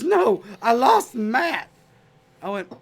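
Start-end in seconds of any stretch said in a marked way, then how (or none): 0.0-1.7 high, loud, slow, drawn out; panicked bartender. Retold or imitated. Bartender telling Patrick he lost Matt, slow and high. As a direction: stretch the words in despair. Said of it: "And he goes, he goes".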